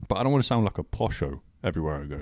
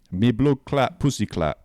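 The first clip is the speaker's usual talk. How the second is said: lightly put-on Jamaican patois; a hint of Jamaican patois; Jamaican accent; 3 semitones higher, 4 dB louder, slower than usual. Shown as a demonstration. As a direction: demonstrate a mock Jamaican accent.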